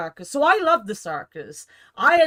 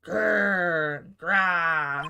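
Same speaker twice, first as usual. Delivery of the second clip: tiger roar; 5 semitones lower than usual. Acted. Tiger charging at Nick.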